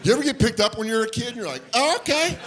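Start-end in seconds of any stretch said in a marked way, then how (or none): 1.7-2.4 high, thin little voice going along with it; high startled squeak; startled kid. Acted. Acts kid being picked up.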